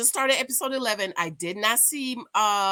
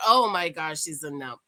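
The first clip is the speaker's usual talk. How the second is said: ordinary talk, nothing marked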